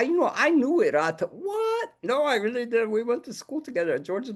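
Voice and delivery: put-on the puppet bragging about an old friend; hammed-up pup boasting; high puppet dog voice. Puppet dog claims to know Erato.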